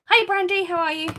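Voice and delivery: loud, high, bubbly greeting; over-enthusiastic. Real, not acted. Streamer greeting a viewer after finishing the tabs, over-enthusiastic. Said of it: "bit too enthusiastic there".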